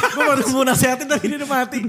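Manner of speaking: laughing through the words. Real, not acted. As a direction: giggle while saying it. Laughing at own awkward remark.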